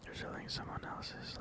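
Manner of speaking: normal talking voice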